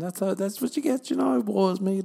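high, loud hammed-up wail; mock woe-is-me. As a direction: wail it in mock self-pity. Hams up self-pity over noise.

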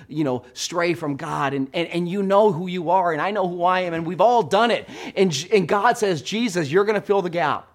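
impassioned pulpit climax, high and loud; full-force preaching. Real, not acted. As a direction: preach it hard, hammering each declaration.

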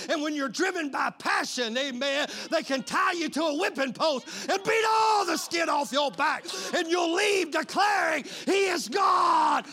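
loud, high shouted preaching; full-force preaching. Real, not acted. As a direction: thunder God's words at the congregation.

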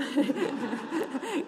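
a rising burst of laughter; real laugh. Real, not acted. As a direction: laugh out at the joke. Preacher laughs at own joke.

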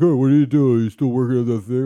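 loud, put-on dad voice; dad barging in with questions over the phone; impression of dad. Dad on the phone while driving, loud impression. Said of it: "your impression of him".

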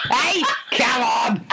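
slow, drawn-out shout of protest; mock outraged shout. Co-host teasing her about the joke, slow mock shout. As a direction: bellow it in slow fake indignation.

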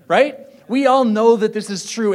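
ordinary talk

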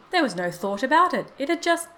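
heated protest, pitch climbing; insistent. A narrator's reading. Old man argues, then breaks off.

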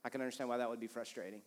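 soft, sincere apology; sincere apology demo. Shown as a demonstration. Pastor showing couples how to apologize, soft and sincere. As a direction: apologize gently and mean it. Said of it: "So, like".

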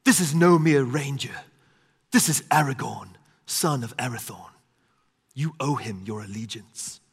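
lightly voiced elf proclaiming; a hint of a noble elf herald; Legolas. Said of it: "he says".

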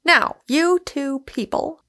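high, slow, cheerful address; cheerfully taking charge. A narrator's reading. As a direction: brightly call the pair to attention. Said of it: "said Michael cheerfully".

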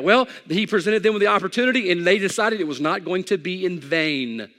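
loud, high, driving sermon voice; full-force preaching. Acted. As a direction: preach it hard to the whole room.

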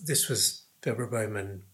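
ordinary delivery

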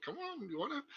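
high, soft mock coaxing; creepish come-on. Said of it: "kinda creepish how I went about it".

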